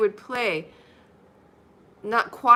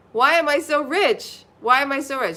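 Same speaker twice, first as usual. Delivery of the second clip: confident affirmation; 5 semitones higher, 7 dB louder than usual. Shown as a demonstration. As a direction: declare it like you truly believe it. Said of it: "if you say that to yourself".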